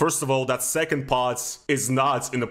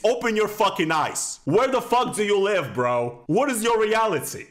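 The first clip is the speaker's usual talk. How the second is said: angry rant; 7 semitones higher than usual. Real, not acted. Ranting at the guy on screen.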